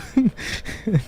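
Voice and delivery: a burst of high, stunned laughter; laughing at a random photo of himself a friend sent; surprised laugh. Real, not acted.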